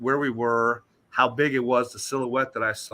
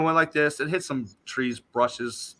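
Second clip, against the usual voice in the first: normal talking voice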